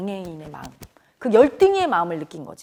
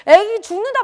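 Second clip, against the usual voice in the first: high, loud frantic cry for help; child screaming for the men as her friend dies, high and loud panic; panicked shouting; 9 semitones higher, 4 dB louder than usual. Retold or imitated. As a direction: call out to the men as if a child is dying. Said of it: "막 소리소리 지르니까".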